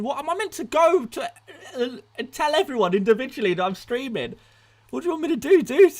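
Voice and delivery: incredulous. Real, not acted. Defends not announcing the stream.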